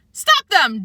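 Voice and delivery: angry official shouting; shouted. A narrator's reading. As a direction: shout the order after them. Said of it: "George shouted".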